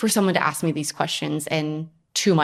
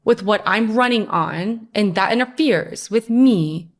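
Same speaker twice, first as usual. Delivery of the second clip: loud, higher, accusing outburst; mock outrage; 5 semitones higher, 5 dB louder than usual. Wife playing her old angry self at her supportive husband, mock furious. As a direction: throw the blame at him in mock fury.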